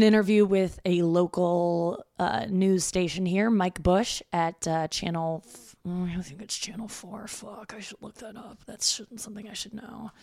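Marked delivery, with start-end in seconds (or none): 5.8-10.1 muttering to herself. Real, not acted.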